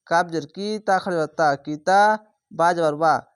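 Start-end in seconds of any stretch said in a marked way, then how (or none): none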